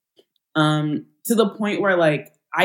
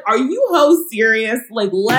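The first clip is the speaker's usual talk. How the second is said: incredulous; 7 semitones higher, 6 dB louder than usual. Retold or imitated. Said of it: "And I'm like".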